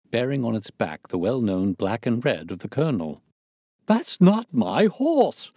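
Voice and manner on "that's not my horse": high, loud, drawn-out protest; horrified owner's outburst; outraged cry. A narrator's reading. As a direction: cry out in indignant disbelief. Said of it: "cried the owner".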